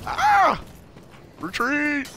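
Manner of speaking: high sudden yelp; startled scream. Real, not acted. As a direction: scream and flee. Ambushed, screams retreat.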